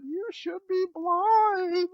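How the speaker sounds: slow, high drawn-out mock wail; mom warning. Mom scolding the boys after the whiskey, slow high mock wail. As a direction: stretch it like a tearful mother. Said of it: "his mom's crying the next morning".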